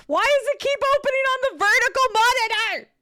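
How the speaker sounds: loud, very high frustrated wail; exasperated yell. Real, not acted. Streamer yells at game opening on wrong monitor, exasperated. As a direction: cry out at a stubborn computer.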